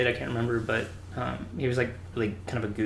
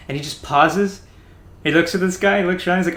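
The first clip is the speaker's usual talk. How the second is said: usual speaking voice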